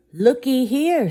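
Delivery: high, loud cook's shout. Acted. Cook spotting the dog, shouting high and loud. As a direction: call out in happy surprise. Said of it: "shouted one of the cooks".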